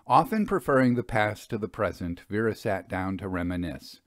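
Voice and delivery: ordinary delivery, nothing marked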